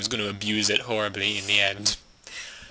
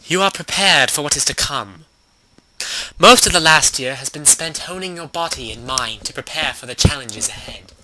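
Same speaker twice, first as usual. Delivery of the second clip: dramatic fantasy narrator voice; story narration; 7 dB louder than usual. Acted. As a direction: read like an epic tale.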